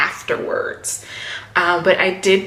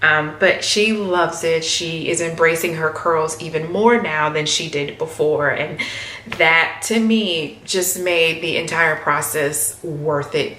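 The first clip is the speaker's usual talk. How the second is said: usual speaking voice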